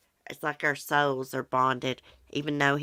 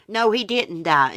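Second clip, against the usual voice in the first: high, loud correction; insistent, pitched-up clarification; emphatic denial; 6 semitones higher, 8 dB louder than usual. Real, not acted. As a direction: jump in to set the viewer straight. Correcting another viewer's mistake.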